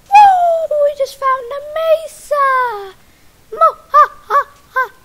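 very high, loud cheer; excited shout. Real, not acted. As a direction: yell with joy. Finding a mesa, cheering.

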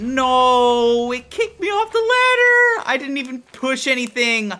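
indignant high-pitched cry; outraged protest. Real, not acted. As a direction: wail in disbelief at the unfairness. Protests fall from ladder.

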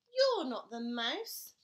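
put-on loud, slow, stubborn child; slow loud child accusation. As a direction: insist it to the owl. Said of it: "child speaks to owl".

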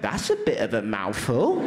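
cheeky second voice. Retold or imitated. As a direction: drop the voice low for the quip. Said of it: "another voice went".

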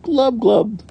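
high, loud, bubbly glubs; fish glubbing. A narrator's reading. Another fish answering, high loud glubs. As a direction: glub like a fish. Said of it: "Another fish is answering".

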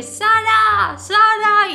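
loud, high-pitched call; imitated shouting. Shouting at a sleeping friend on the train, reenacted. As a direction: call out hard as if waking someone. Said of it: "empezaba a gritarle".

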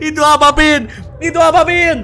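loud, high, urgent question; high yelled warning question; alarmed shout. Real, not acted. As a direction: call out in alarm to a teammate. Calls out alarm at something seen.

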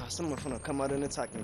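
usual speaking voice

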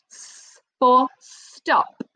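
"S for stop" slow, stressing the S; slow emphasis. Shown as a demonstration. Teacher circling the answer, slow stressed demo. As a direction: linger on "S for stop". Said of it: "Can you hear s?".

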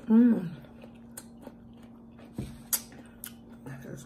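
talking between bites; chewing crunchy food; mouth full, chewing. Real, not acted. Seen 1: bites cucumber at 3.3, then chews with closed lips.

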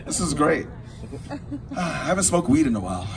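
ordinary talk, nothing marked

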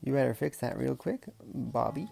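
usual speaking voice

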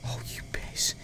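hushed, breathy insult; whispered curse. Real, not acted. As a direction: whisper it so nobody hears. Hushed aside during game.

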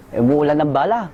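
ordinary talk

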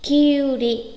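"Kyuri" with u held long; correct long vowel. Shown as a demonstration. Teacher repeating the right form, "Kyuri" with long yu. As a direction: stretch "Kyuri" on the yu, short ri. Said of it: "mà đọc là Kyuri".

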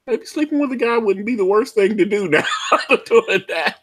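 giggling through the words; laughing. Retold or imitated. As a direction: laugh while saying it. Quoting self, laughing.

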